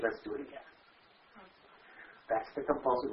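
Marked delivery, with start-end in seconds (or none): 0.0-0.7 hushed, low inner voice of insanity; near-voiceless low murmur of the compulsion. Retold or imitated. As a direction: whisper it like the addiction tempting you. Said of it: "I have an insanity that says".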